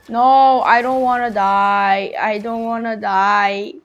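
desperate loud no; loud panicked pleading. Real, not acted. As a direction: beg loudly in panic. Character dying in the game.

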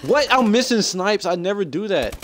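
high, incredulous exclamation; real disbelief. Real, not acted. Gamer exclaiming over missed snipes, incredulous. Baffled at own missed shots.

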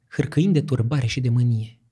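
usual speaking voice

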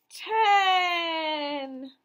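loud, thrilled cry of the answer; excited shout. Acted. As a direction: cheer the answer out for the kids. Teacher reveals answer to children.

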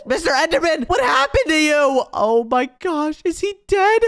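high, panicked outcry; alarmed. Acted. Customer seeing the Enderman suddenly die, high alarmed cry. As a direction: cry out in acted shock, voice shooting up.

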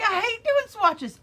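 high, loud mock outburst; protesting student. Student refusing to make swatches, mock outburst. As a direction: imitate a student's emphatic whine.

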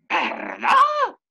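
stunned, as if he misheard; shocked pardon. Retold or imitated. Retelling his shocked reaction.

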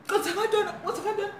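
high-pitched, startled mock panic; startled waking. Girl woken by a stranger grabbing her, startled. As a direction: act out waking in alarm. Startled awake in retold break-in.

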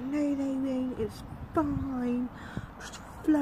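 lightly mocked carefree girl; mocking airy friend. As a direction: imitate her careless shrug. Friend brushing off worry.

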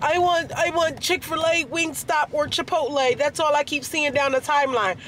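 high whiny wife voice; imitating wife. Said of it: "starts saying".

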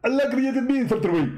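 high-pitched mock debater voice; a squeaky, smug rival preacher; Zakir Naik impression. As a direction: push the voice way up and lampoon him. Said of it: "how you talk like that".

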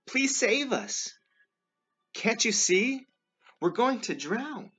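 high, slow, loud pleading; shouted plea. A narrator's reading. Frightened disciple begs Jesus in the storm, shouted plea. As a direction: cry out desperately for rescue. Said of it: "he shouted".

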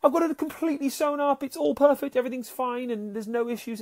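high, sing-song pretend cheer; mocking fake-fine. Mocking people who hide their struggles, high fake-cheerful voice. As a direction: mimic people pretending all is perfect. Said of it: "we hide behind the veil of".

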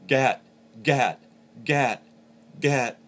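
loud, high GAT like a goose-call note. Shown as a demonstration. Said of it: "in a higher pitched voice".

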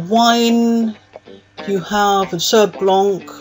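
usual speaking voice

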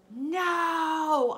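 high, loud shout of no; desperate yelled no. A narrator's reading. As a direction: cry out to stop him. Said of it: "I yelled".

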